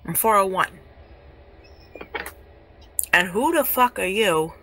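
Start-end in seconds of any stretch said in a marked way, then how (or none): 3.1-4.5 indignant demand with a louder, goading tag; angry challenge. Real, not acted. Streamer challenging absent ex over his claims, angrily. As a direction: confront them and demand an answer.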